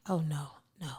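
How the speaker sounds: hushed, whispered mock dismay; dismayed reaction. Reacting to rap snippets on social media, whispered dismay. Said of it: "And I'm like".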